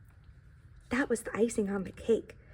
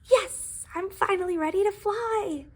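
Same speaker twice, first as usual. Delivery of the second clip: triumphant cheer; 8 semitones higher, 4 dB louder than usual. Acted. Pie triumphant exclaim.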